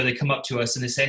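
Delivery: ordinary delivery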